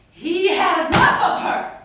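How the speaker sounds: loud, high, rising cry; explosive outburst. Acted. Poet telling of his last loss of patience, explosive. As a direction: burst out at full force. Climax of the man's anger.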